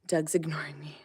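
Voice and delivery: breathy whisper, just to the viewers; whispered aside. Real, not acted. Owner whispering to viewers about her ignoring alpaca, whispered. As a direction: whisper it so the animals don't hear.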